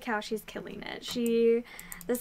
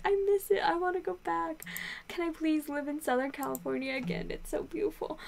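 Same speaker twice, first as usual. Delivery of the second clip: higher, whiny homesick lament; wistful longing; 6 semitones higher than usual. Retold or imitated. Reviewer mock-whining about missing Southern California, high and longing. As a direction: pine for it like a kid begging to go back. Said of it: "I was just like".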